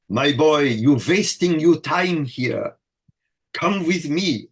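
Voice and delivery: lightly imitating an old Hungarian conductor's accent. Conductor inviting a young student to the conservatory, light Hungarian accent. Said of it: "in his Hungarian accent".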